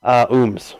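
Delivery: "Ooms" said with a long oo as in moon; name pronounced. Shown as a demonstration. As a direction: say "Ooms" clearly, long oo then ms. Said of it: "official pronunciation on your last name".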